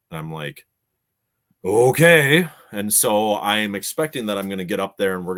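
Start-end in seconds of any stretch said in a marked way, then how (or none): 1.6-2.5 loud, high, rising okay; wary okay. Retold or imitated. Reacting to wife's urgent text, wary and puzzled. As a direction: give in loudly with a questioning lift.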